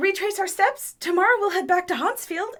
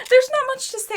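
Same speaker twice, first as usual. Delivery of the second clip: laughing; 9 semitones higher, faster than usual. Acted. Embarrassed deflecting.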